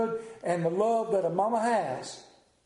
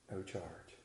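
much softer and lower, barely there; hushed tender; 11 semitones lower, 17 dB quieter than usual. Acted. Mother answering her son's bill, softly and tenderly. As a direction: let it fall to a near-hush.

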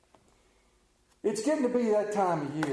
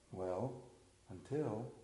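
much softer and lower "Well, until", rising; soft low answer "Well, until"; 8 semitones lower, 13 dB quieter, slower than usual. Acted. God answering his children, soft low "Well, until". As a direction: answer gently, "Well, until" like a patient father.

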